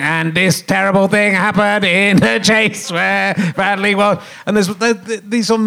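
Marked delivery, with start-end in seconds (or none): 0.0-4.2 robot voice. Retold or imitated. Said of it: "voiced by a robot going".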